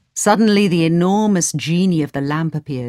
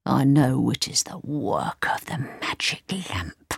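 soft whispered wizard thought; plotting whisper; scheming whisper; 4 semitones lower, 6 dB quieter than usual. A narrator's reading. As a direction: whisper the secret to yourself. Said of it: "the wizard thought".

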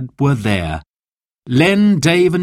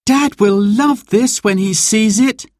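put-on high, happy child's voice; delighted child; 11 semitones higher, 3 dB louder than usual. A narrator's reading. Girl admires banner made for Dad, delighted, high. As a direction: gush like a pleased little girl.

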